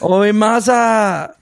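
slow, high, drawn-out Australian "Oi, muzza."; Aussie slang. Retold or imitated. Upping the Aussie version of mum, slow high laughing drawl. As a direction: stretch "muzza" out slowly, laughing. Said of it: "it should be like".

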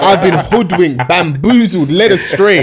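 loud, high theatrical indignation; hammed-up cry of betrayal; mock outrage. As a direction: declaim it like a betrayed orator, half laughing. Acts out the feeling of betrayal.

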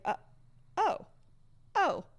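high, breathless stammering oh, oh, oh; shaky shocked gasps. Acted. Amelia, hit by a safety arrow, stammers in high shock.

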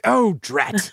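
hammed-up little curse; mock dismay. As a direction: act out a mild "foiled" reaction. Voicing boy caught by trick.

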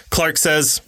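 usual speaking voice

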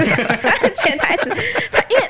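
high laughing speech. Real, not acted. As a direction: laugh through the words. Laughing mid-story.

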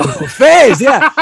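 giggly, delighted recall; laughing. Real, not acted. Laughing about the buffets.

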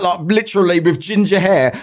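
ordinary delivery

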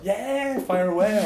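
high, bright eager yes; breezy eager. Retold or imitated. Recycling officer cheerfully agreeing to a reporter on the phone. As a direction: play your naive eager younger self. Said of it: "And I was like".

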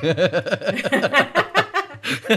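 high, loud burst of laughter. Real, not acted. Co-host cracks up over the thermos joke, laughing.

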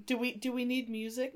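normal talking voice

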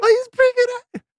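mock-surprised falsetto compliment; high-pitched praise. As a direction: rattle off grudging praise in a squeak. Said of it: "you say it in a high-pitched way".

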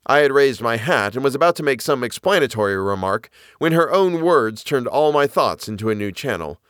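ordinary delivery, nothing marked

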